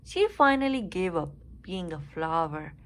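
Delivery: ordinary delivery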